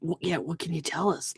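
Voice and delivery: hushed, probing half-whisper; sly. Acted. Player's character asking the owl for inside information, sly and hushed. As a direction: fish for secrets under your breath. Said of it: "trying to be all sly".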